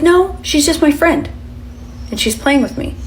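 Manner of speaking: voce da bambina più acuta e più forte; la madre imita la figlia che saluta; little girl voice. Retold or imitated. Daughter voiced high.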